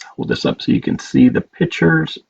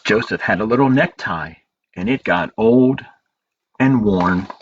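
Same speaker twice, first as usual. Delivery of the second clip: story narration; 3 semitones lower than usual. A narrator's reading. Librarian reading a picture book to children, gentle storytelling.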